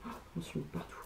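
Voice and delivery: muttered whisper to herself; unvoiced muttered aside. Real, not acted. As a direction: drop to a whisper, a quick aside. Aside while getting product everywhere.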